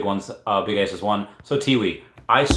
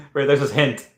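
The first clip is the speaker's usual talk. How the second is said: ordinary delivery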